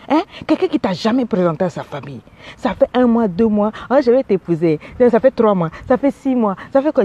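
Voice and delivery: ordinary delivery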